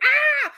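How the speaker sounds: high short mock shriek; mock scream. Fan acting out panic as everything falls, mock shriek. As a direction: throw out a quick playful yelp.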